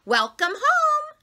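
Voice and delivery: very high, loud sing-song welcome; cheery mother. Acted. Mother welcoming her daughter home, high cheery greeting.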